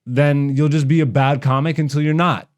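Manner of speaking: ordinary delivery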